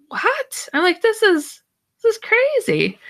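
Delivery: mock astonishment at a wild story; lightly acted amazement; stunned disbelief. Her own stunned reaction retold.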